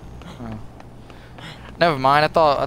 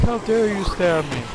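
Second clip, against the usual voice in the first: voicing the zombie; 6 semitones higher than usual. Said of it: "He's like".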